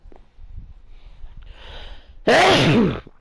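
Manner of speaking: harsh, loud coughing; coughing fit. Real, not acted. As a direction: cough hard, caught off guard.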